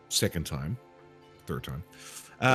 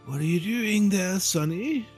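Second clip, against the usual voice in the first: put-on very old woman; 9 semitones higher than usual. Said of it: "she looks at you and she says".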